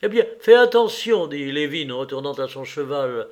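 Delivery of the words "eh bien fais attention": raised, louder call to attention; firm instruction. A narrator's reading. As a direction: instruct him in a lifted, emphatic voice. Master instructing a labourer.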